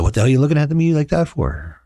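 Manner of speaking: high, mock-indignant question; playful mock outrage; retold challenge. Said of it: "And I said".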